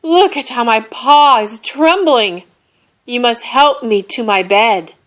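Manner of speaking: high, loud self-pitying plea; wheezy sick plea. A narrator's reading. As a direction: plead like a dramatic invalid. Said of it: "Bear wheezed".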